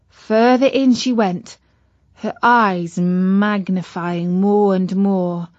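plain reading voice

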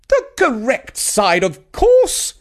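put-on high, loud, imperious old woman; haughty Grandma. A narrator's reading. Grandma correcting the guests, high and haughty. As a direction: look down your nose at him. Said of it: "she replied".

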